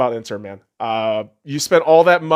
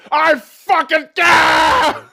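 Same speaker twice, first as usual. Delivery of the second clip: high, loud mock battle yell; brute roar; 13 semitones higher, 5 dB louder, much slower than usual. The Mountain finishing the Viper, hammed-up roar. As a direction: crush him with a roar. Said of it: "the Mountain just gets on the".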